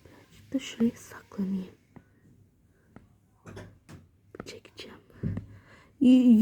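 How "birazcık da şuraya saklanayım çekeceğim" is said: barely audible whisper. Real, not acted. Girl whispering from hiding. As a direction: keep almost silent.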